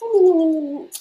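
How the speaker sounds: high laughing sigh. Real, not acted. Laughing after joke.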